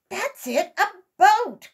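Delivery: high and loud burst of relief; the idea hits like a flash; excited discovery. A narrator's reading. Uncle Wiggily hitting on the boat idea, excited. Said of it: "cried Uncle Wiggily".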